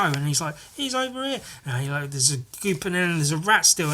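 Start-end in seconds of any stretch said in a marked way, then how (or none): 0.8-1.4 high, soft call; small, high mock voice; high character voice. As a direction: pitch it way up, quietly. Voicing a character.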